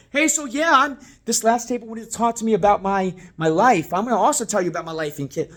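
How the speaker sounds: loud, high, intrusive chatter; overeager oversharing. Shown as a demonstration. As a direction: butt in loudly, mock overfriendly. Demo of intruding on guests.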